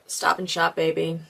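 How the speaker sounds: ordinary talk, nothing marked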